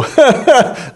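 high mock cackle; high-pitched put-on laugh of ridicule. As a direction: imitate a crowd jeering with laughter. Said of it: "everybody would just go".

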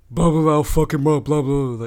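loud blustering imitation of a mad guy; hammed-up angry babble; mock angry guy. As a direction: mimic a guy ranting in gibberish. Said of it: "the guy is getting mad. He's like".

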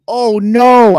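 high, loud fake dismay; exaggerated pretend shock; mock horror. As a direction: exclaim it as if scandalized, sarcastically. Feigns shock at tub picture.

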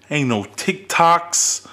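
ordinary talk, nothing marked

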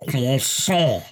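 very nasal croissant, hammed-up French accent; nasal mock French. Showing it must be nasal, mock French accent. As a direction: push croissant through the nose like a Parisian. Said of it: "It's gotta be very nasally".

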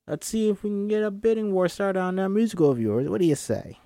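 put-on salty old-school agent, high voice; Rosa Stevens. Agent selling John on a bidding war, salty high voice. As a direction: pitch it up and sell the idea.

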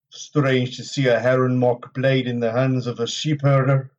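slow, level character voice; hard, stern warrior. A narrator's reading. Lan's apparition questioning Rand's worth, hard and stern. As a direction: challenge him with measured, stony restraint. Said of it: "stare was as hard as granite".

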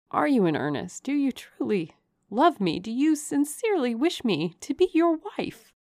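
wondering questions climbing high; earnest young governess, voice lifting; hopeful heroine. A narrator's reading. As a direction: ask with mounting, disbelieving hope.

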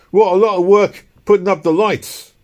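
working man. A narrator's reading. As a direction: voice a labourer remarking out loud. Working man's street talk, acted.

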